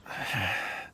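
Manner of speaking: a soft, heavy sigh of exhaustion; weary sigh. Acted. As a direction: let out a long, tired sigh, fading to near silence. Dizzy man sighs heavily.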